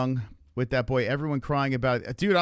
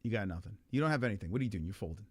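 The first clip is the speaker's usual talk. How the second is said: normal talking voice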